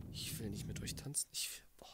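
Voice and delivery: breathless murmur after the fright; shaken. Real, not acted. (leise, erschrocken geflüstert)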